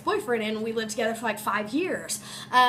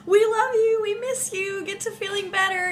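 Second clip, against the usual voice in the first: put-on kids' voices; 7 semitones higher than usual. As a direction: imitate the sweet kids cheering her up. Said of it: "They were like".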